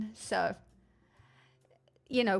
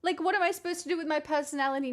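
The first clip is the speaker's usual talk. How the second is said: high, flustered mock protest; high-pitched, hammed-up fluster; playfully shy; 7 semitones higher, 5 dB louder than usual. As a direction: squeal at a newcomer, thrown off and bashful. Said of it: "now I'm all shy".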